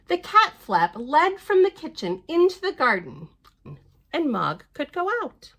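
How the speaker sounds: lively storybook reading voice; picture-book narration; reading to children. Acted. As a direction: read it out brightly to little ones. Reads the book aloud.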